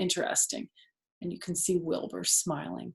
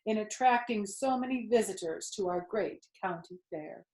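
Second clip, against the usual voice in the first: loudspeaker announcer; 5 semitones higher than usual. Acted. Fair announcer booming praise of Wilbur to the crowd. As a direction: proclaim to the whole fair.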